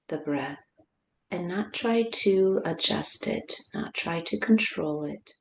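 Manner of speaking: slow, unhurried guide with long pauses; guided meditation. Real, not acted. Teacher guiding listeners to feel the breath, calm meditation voice. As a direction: ease them into the breath, sinking to near-whisper at the end.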